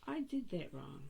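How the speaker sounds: high, soft gasp of dismay at her own slip; gasping realisation. Real, not acted. Teacher to class, catching her own mistake with a soft high gasp. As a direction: catch your breath as you spot the mistake.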